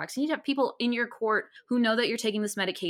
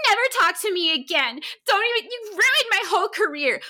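high, shrill-free mock outrage, louder; imitating Kate's huffy outburst, pitched up; mock outraged Kate; 11 semitones higher, 4 dB louder than usual. Friend mocks Kate telling the reviewer off, high and louder. Kate's furious reply retold.

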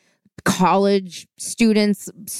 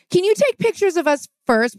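put-on much higher, bubbly college-girl voice; ditzy sorority girl; 11 semitones higher than usual. Drunk students ask the newly engaged couple for photos, in a much higher bubbly voice. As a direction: chirp it like a tipsy sorority girl.

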